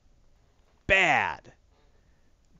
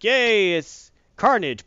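mock cheer; 5 semitones higher, 6 dB louder, much slower than usual. Real, not acted. Mocking the Red Goblin reveal.

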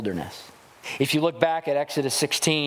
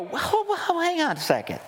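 mocking high-pitched disbelief, pushing back; scoffing townsfolk who know his family; incredulous skeptics; 7 semitones higher than usual. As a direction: play the doubting crowd, scoffing and pitched way up. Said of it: "And they go".

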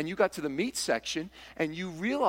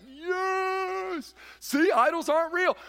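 triumphant cheer; 13 semitones higher, much slower than usual. Retold or imitated. Half of the church cheering Paul's verdict, triumphant. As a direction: cheer it like your side just won. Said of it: "this half of the church be like".

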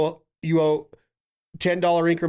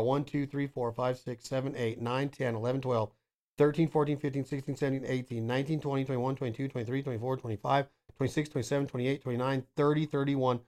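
counting the days off under his breath; a soft, fast count to thirty-one; quiet quick count; 4 semitones lower, 8 dB quieter than usual. Shown as a demonstration. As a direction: rattle off the numbers low and soft.

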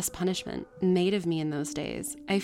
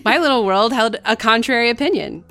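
louder, laughing as she reads; amused laugh carrying the line; laughing through it; 5 semitones higher, 13 dB louder than usual. Real, not acted. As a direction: giggle the words out. Laughs while reading.

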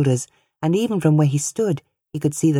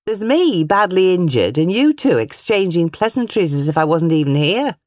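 raised, louder playful complaint; mischievous teasing; 4 semitones higher, 4 dB louder than usual. A narrator's reading. Mother teasing Ben and Mary for ignoring her, mischievous mock complaint. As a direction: tease them with mock offence. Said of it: "With a mischievous smile on her face".

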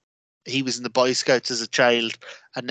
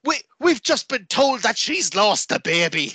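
high-pitched mock shock; melodramatic commentator; 7 semitones higher than usual. Commentators revealing a tasteless storyline twist, mock-horrified. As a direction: announce it as overblown wrestling drama.

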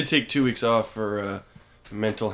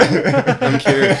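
laughing; 9 semitones higher, 8 dB louder than usual. Acted. Repeats claim while laughing.

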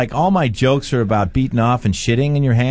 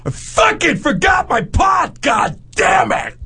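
high, slow, yelled frustration; mock furious rant; 12 semitones higher, slower than usual. Friend raging about his forgotten pot, high slow yell. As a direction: bellow it like a tantrum. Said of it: "He goes".

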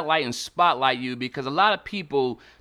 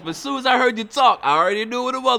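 excited shout-out; 9 semitones higher, 7 dB louder than usual. Real, not acted. Host excited about Brooklyn.

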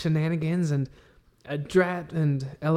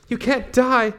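desperate plea; 9 semitones higher than usual. Acted. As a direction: beg a dying friend to hold on. Healing dying friend.

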